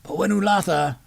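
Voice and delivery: lightly voiced tutor brother; Shandar explaining. A narrator's reading. Brother teaching sister runes, lightly voiced.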